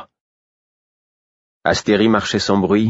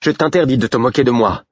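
higher, louder angry warning; angry girl; 6 semitones higher, 3 dB louder than usual. A narrator's reading. Girl angrily forbids the shepherd to mock her. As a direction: she forbids him, rising in anger. Girl furious at being mocked.

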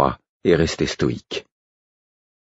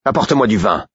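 higher, sharp irritated king; 7 semitones higher than usual. A narrator's reading. The king irritably orders wine from a servant. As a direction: snap the order like an annoyed king. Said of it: "d'un ton irrité".

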